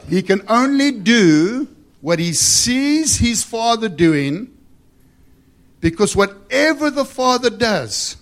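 loud, high, stretched preacher's delivery; forceful scripture reading. Acted. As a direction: proclaim the verse at full force.

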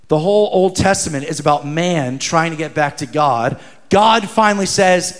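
ordinary delivery

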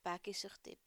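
plain reading voice

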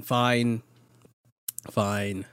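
normal talking voice

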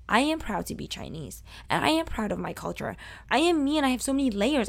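ordinary talk

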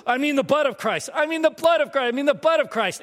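high-pitched, flustered retries, tripping over the word; panicked correction. Retold or imitated. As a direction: blurt mock-panicked corrections that keep coming out wrong. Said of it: "you are so panicked now".

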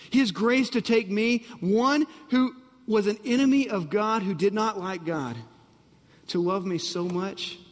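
ordinary talk, nothing marked

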